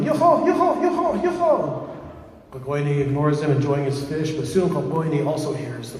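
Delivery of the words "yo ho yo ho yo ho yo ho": very high, urgent repeated call; yelling. A narrator's reading. Ignored eye warns of the men, high yelling. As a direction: holler it right up high. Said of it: "The eye's yelling".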